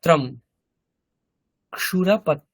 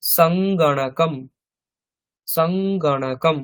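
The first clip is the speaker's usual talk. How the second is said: slow modeling; slower than usual. Shown as a demonstration. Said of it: "সংস্কৃতে বলছি সঙ্গণকম".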